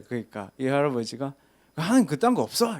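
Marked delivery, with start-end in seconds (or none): 1.8-2.8 high, loud dismissive retort; angry old man. Retold or imitated. Old man dismissing the preacher, high and angry. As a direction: wave him off with scorn. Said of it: "화를 내시는 거야".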